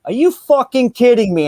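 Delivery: high, loud, furious gamer outburst; angry yell. Retold or imitated. Friend mid-game yelling at his screen, loud and furious. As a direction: blow up at the game like Miguel did. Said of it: "I hear Miguel going".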